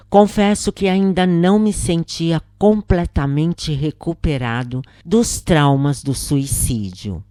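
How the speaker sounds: ordinary talk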